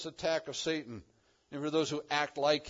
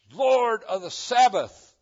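slow, loud, higher declaration of Lord; pushed to the front; 7 semitones higher, 12 dB louder, slower than usual. Shown as a demonstration. Jesus declaring to the Pharisees, slow and emphatic. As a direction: proclaim "Lord of the Sabbath" up front. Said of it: "pushes it right up to the front".

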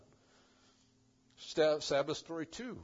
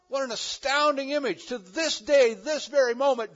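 louder, higher, driving sermon voice; full-force preaching; 8 semitones higher, 8 dB louder than usual. Acted. Pastor exhorting the congregation, full force. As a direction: preach it out with rising fervor.

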